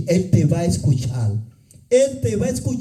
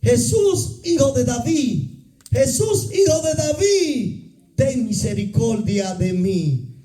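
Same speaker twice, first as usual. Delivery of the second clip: blind man crying out; 5 semitones higher than usual. Retold or imitated. Blind Bartimeo shouting to passing Jesus, desperate cry. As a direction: call out across the road, begging to be heard. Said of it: "comienza a dar voces".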